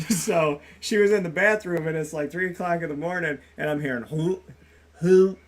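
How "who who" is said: imitated retching, two short bursts; dry heave. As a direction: mimic someone heaving in the next room. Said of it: "starts to dry heave".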